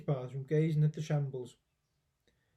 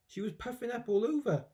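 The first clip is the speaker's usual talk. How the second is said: plain reading voice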